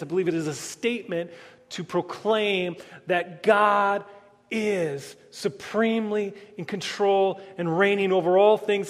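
ordinary delivery

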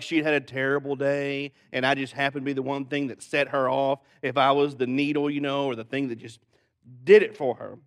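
usual speaking voice

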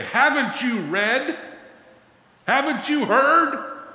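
high, slow, ringing challenge from Jesus; voicing Jesus. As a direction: put the question to them like Jesus would.

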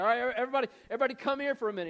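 high, loud call to a crowd; yelling out. Retold or imitated. Jesus gathering the crowd from a rock, calling out. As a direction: call everyone over from a hilltop. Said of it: "he, like, yells out to everybody".

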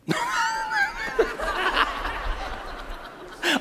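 big hearty laugh breaking out; explosive laugh. Real, not acted. Laughs at own joke.

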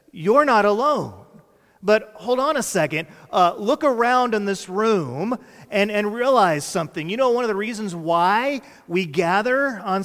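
loud, high, driving sermon voice; rally the room with emphatic, rolling phrases; full-force preaching. Real, not acted. Preacher telling congregation and online viewers they're not alone, full-force.

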